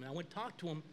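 ordinary talk, nothing marked

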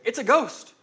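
high, loud startled cry; terrified cry. Retold or imitated. Terrified disciples spotting a figure on the water, loud alarmed cry. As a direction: yell it out in alarm. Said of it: "they were terrified and said".

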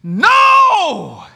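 put-on toddler's high, loud scream; all-out high scream of protest. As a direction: shriek it like a child guarding candy. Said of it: "And he screams".

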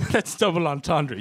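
ordinary talk

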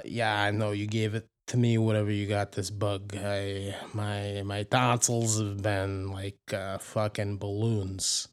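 usual speaking voice

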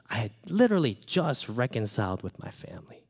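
usual speaking voice

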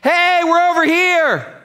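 high, loud, drawn-out call; hammed-up parent shout; mock parent yelling. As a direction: holler across the parking lot like an embarrassing parent. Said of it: "Just scream at them".

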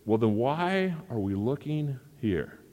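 a touch of exasperation, slowed down; lightly voiced annoyed man, drawn out; understated frustrated question; mildly frustrated. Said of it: "got really frustrated and says".

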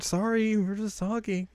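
high, sheepish mock excuse; acted apology. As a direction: excuse yourself in a small high voice.

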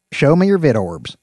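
loud, firm dog-trainer command. Acted. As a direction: bark it at the dogs.

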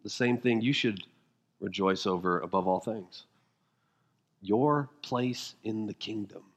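low, soft, intimate; hushed sermon voice. Acted. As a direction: draw them in quietly. Hushed answer to rhetorical question.

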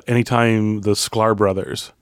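ordinary talk, nothing marked

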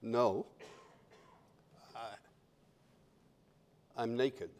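very soft, low, halting confession; small, hushed, embarrassed reply; timid voice. Acted. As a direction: admit it meekly with long hesitations. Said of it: "this quiet little timid voice".

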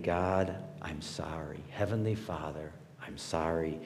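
quiet, low contrite apology; soft prayer. Shown as a demonstration. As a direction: murmur it softly as a repentant prayer. Repeated humble prayer acted.

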